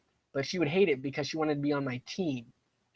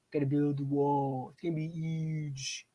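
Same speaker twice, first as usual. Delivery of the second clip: a slow, drawn-out take on Trump, lightly done; slightly off-voice mock Trump, stretching the words; Trump impression; slower than usual. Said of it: "That was my best Trump imitation".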